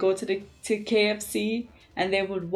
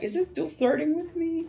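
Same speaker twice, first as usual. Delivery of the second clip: incredulous thought; 8 semitones higher than usual. Retold or imitated. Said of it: "I'm there like".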